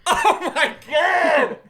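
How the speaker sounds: laughing slow oh my God; cracking up in disbelief. Retold or imitated. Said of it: "It was like".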